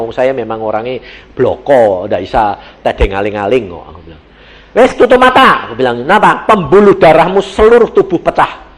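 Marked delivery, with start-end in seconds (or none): 4.7-5.7 loud, high retold command; blunt order. Husband tells stricken wife to close eyes, blunt loud order. 6.0-8.7 loud, raised retold diagnosis; blunt announcement. Husband tells wife her vessels burst, blunt loud telling.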